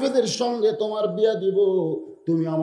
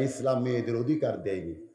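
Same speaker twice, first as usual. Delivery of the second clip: lower, softer quote of the critics; low-key quote; 8 semitones lower, 6 dB quieter than usual. Retold or imitated. Preacher quoting critics of Islam, low and subdued. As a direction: report their claim low and subdued.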